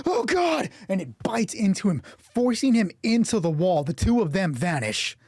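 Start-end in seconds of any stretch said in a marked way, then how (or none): 0.1-0.7 high-pitched cry of a doomed victim; terrified cry. Acted. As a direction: yelp it in horror, voice shooting up. Victim sees the beast beside him.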